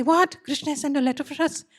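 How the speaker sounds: high, eager gasp of joy; excited disbelief. Acted. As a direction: burst out, thrilled at the news. Gopis hearing of Krishna's letter.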